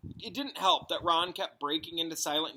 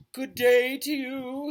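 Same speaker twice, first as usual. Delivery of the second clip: high, slow, airy mystic greeting; misty seer voice; 11 semitones higher, 10 dB louder, slower than usual. A narrator's reading. Trelawney drifting out of the shadows to greet the class, in a misty voice. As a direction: float the words in like a dramatic seer. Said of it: "the same familiar misty voice".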